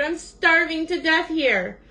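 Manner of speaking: high, mildly whimpered complaint; whimpering. A narrator's reading. Starving Bird complains to himself, high mild whimper. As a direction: moan about hunger in a high bird voice. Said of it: "whimpered Bird".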